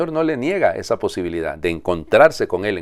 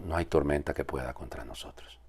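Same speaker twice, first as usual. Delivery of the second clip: soft; 3 semitones lower, 11 dB quieter than usual. Acted.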